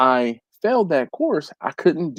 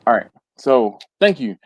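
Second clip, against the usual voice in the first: ordinary delivery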